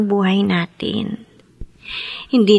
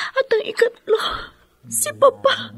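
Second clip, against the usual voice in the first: tearful; 12 semitones higher, 3 dB quieter than usual. Acted. Daughter tearfully telling brother of father's stroke, crying. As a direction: voice rising into a sob. Grieving over father's illness.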